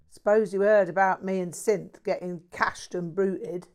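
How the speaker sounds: a mouthy London bloke bragging; lightly voiced cheeky Cockney; Cockney mate. Jack voiced.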